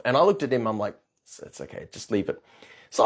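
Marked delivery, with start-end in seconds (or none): 1.2-2.4 near-whispered, low retold aside; hushed aside. Man quietly telling his client to leave it, hushed. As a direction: say it under your breath, barely audible.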